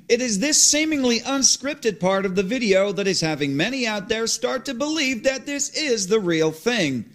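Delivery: crisp TV news read; news anchor read. Acted. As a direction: report it like a newsreader on air. TV news report on screen.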